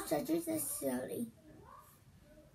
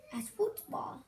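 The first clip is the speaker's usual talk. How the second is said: whispering; 3 dB quieter than usual. Acted. Whispered joke punchline.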